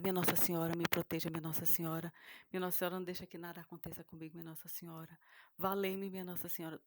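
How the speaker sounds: pleading prayer to the Virgin Mary, loud and urgent; desperate prayer. Retold or imitated. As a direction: beg Our Lady for protection. (prece desesperada em voz alta)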